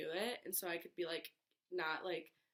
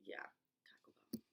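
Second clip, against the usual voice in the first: whisper; 11 dB quieter, faster than usual. Real, not acted. Whispered aside to self.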